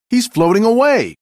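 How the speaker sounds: as the thief drifts off; alarmed cry. Acted. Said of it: "cried Lightning".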